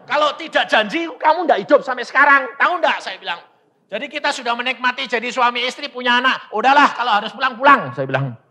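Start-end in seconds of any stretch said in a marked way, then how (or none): 0.1-3.0 high, loud acted retort; retold heated argument. Husband defends his vow to wife, heated. 3.9-7.9 high, loud, fast retort; retold urgent argument. Husband persuades wife to accept, urgent and loud.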